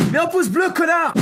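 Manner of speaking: high, loud insulting yell; shouted demand. Real, not acted. Streamer demanding a like from viewers, shouted. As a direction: yell it as a sudden burst of abuse.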